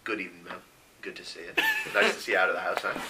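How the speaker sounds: low, very soft voice; tender greeting. Acted. Mara kneeling by Saki, holding her hand, soft tender greeting. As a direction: greet her gently, nearly under the breath.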